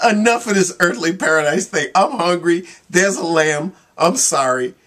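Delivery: hammed-up hungry beast losing patience; hungry lion. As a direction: play the lion who has had enough. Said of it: "the lion gets hungry and say".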